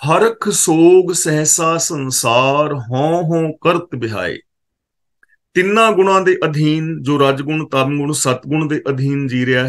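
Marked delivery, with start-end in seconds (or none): 0.1-4.4 slow, drawn-out chanted recitation of the verse; scripture recitation. Acted. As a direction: intone the holy line slowly, word by word. Repeats the verse intoned.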